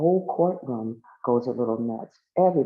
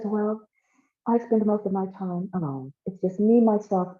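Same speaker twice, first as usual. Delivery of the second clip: higher-pitched character voice; lightly voiced stately woman; 6 semitones higher than usual. Paula Pronoun tells of being alone, lightly voiced higher. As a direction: pitch it up slightly for a lonely character.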